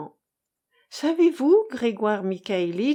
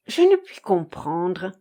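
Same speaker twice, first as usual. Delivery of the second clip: ordinary delivery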